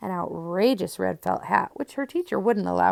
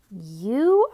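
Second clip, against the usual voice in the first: cried out; 5 dB louder than usual. A narrator's reading. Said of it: "I cried".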